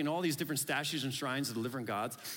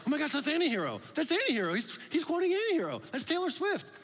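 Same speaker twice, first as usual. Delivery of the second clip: much higher and louder, squealing with recognition; higher and louder, giddy fan excitement; gushing fangirls; 12 semitones higher, 4 dB louder, faster than usual. Retold or imitated. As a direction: shriek it like a starstruck Swiftie. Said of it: "now they're like".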